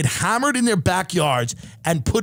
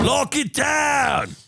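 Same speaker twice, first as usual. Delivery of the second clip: slow drawn-out mock bellow, higher and punchy; mock order shout; 6 semitones higher, much slower than usual. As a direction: bark it slowly like a ridiculous decree. Mocking Australian lockdown orders.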